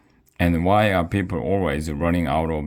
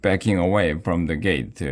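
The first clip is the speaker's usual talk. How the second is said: usual speaking voice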